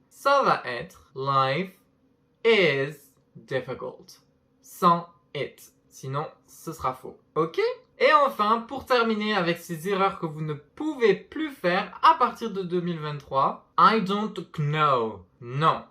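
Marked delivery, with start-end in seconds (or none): 1.1-4.2 slow "life is difficult" with a pause before "is" and before "difficult"; slow careful model. Shown as a demonstration. Teacher models the correct sentence slowly. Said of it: "la vie est difficile". 13.8-15.3 "know" said as "no", slow, K silent. Shown as a demonstration. Teacher models "know" without the K. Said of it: "Le K dans ce mot ne se prononce pas".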